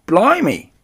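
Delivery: high, loud cabbie exclamation; startled. A narrator's reading. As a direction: blurt it out in astonishment. Startled taxi driver sees mouse.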